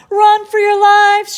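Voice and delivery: high, loud slow shout. A narrator's reading. Neil warning everyone on the beach, shouting loud and slow. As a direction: bellow the warning. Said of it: "shouted Neil".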